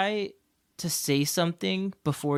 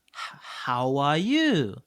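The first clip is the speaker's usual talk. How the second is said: put-on slow, drawn-out Japanese-accented English; exaggerated Japanese accent; slower than usual. Teacher greeting a struggling pupil in exaggerated Japanese-accented English. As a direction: stretch each word in a heavy Japanese accent. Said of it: "you have to exaggerate it a lot".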